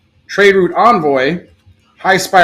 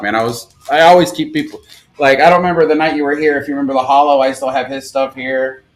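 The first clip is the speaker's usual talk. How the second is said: normal talking voice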